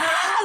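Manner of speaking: very high, loud raging yell; war cry. Retold or imitated. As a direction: howl it like the Tasmanian devil. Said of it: "And I was like".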